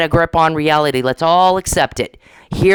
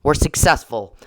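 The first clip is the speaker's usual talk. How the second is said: usual speaking voice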